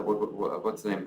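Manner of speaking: normal talking voice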